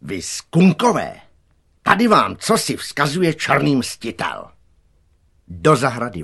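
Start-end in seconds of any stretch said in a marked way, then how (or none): 0.0-4.5 loud, raised taunting call; calling over a fence. A narrator's reading. Rival boy behind the fence to the gang, calling out. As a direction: hurl a challenge at the rival gang. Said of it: "za plotem ozval Vincův hlas".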